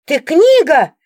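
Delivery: sharp loud exclamation; startled shout; exclaimed. A narrator's reading. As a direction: blurt it out in shock. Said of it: "воскликнула я".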